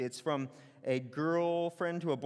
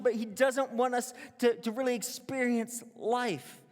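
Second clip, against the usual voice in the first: a light mocking serpent, raised and louder; sneering serpent; 7 semitones higher, 4 dB louder than usual. The serpent jeering at God to the woman, lightly mocking. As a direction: scoff like the snake sowing doubt. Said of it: "to turn up its nose, jeer, and scoff".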